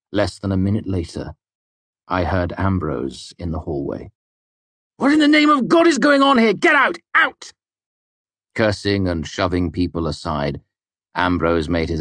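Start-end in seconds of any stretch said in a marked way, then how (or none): 5.0-6.5 angry shouting. A narrator's reading. Ambrose bursts in yelling. 6.5-7.0 angry shout. A narrator's reading. Ambrose yelling. 7.1-7.5 angry shouting. A narrator's reading. Ambrose bursts in yelling.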